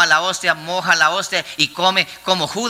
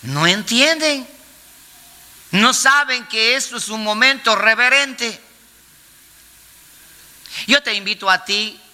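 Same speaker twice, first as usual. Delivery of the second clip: ordinary talk, nothing marked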